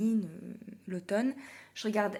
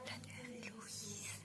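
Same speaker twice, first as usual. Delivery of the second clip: whisper; 17 dB quieter, slower than usual. Real, not acted. Hushed aside to someone nearby.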